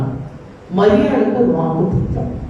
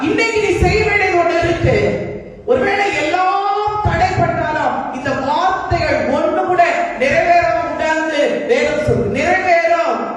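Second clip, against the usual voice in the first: high-pitched, ringing sermon declaration; impassioned preaching; 10 semitones higher than usual. Real, not acted. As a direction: proclaim it to the congregation, voice pitched high. Preacher declares God's word with force.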